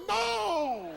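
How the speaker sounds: very high, held-out cry; hammed-up horrified shriek; mock yell. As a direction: yell it as one long, high wail. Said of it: "They yelled".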